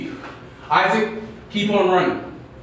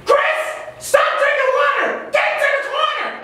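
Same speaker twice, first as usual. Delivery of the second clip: high sharp coach yell; 9 semitones higher than usual. Acted. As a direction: bark it across the court. Coach yelling at player.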